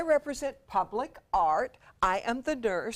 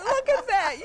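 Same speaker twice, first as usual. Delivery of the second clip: higher, louder delighted exclaim; excited; 10 semitones higher, 3 dB louder than usual. Real, not acted. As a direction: gush over the costume. Admiring the costume.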